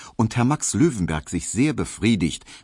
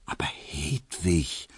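slow, much softer, pleading hush; urgent whisper; 3 semitones lower, 6 dB quieter, slower than usual. A narrator's reading. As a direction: plead under your breath. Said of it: "flüsterte Emil Kubinke eindringlich".

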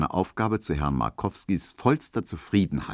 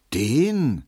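verächtlich; 6 semitones higher, 4 dB louder than usual. A narrator's reading. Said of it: "meinte Hedwig verächtlich".

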